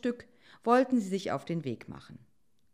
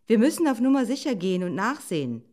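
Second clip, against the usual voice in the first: louder, higher, quick fox voice; brisk fox; 5 semitones higher, 8 dB louder, faster than usual. Acted. Fox proposing a check to the wolf, brisk and insistent. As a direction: push the cautious plan briskly.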